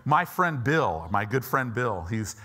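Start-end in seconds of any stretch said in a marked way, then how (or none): none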